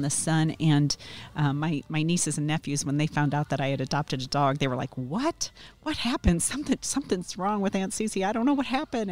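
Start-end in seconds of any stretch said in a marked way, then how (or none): 5.0-9.1 imitating baffled relatives, higher; pitched-up mock alarm; alarmed disbelief. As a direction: react like stunned nieces and nephews. Nieces and nephews' alarmed reaction.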